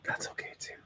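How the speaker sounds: barely audible whisper; whispered self-reassurance. Real, not acted. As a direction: whisper it to yourself. Reassures self over cluttered screen.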